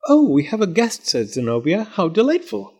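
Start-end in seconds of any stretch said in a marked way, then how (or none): 0.1-1.1 high, bright doll voice; sweet little girl voice; Zenobia voice. Acted. Zenobia speaks. 1.8-2.7 high delighted exclaim. Acted. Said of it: "Zenobia delighted".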